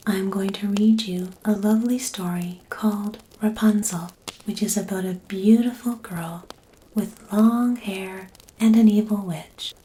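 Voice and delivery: usual speaking voice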